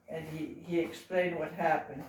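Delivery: ordinary delivery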